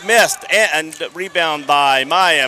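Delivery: loud, driving live game call; excited play-by-play. Real, not acted. Commentator following Maya down court, excited play-by-play. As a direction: call the fast break loudly, riding the action.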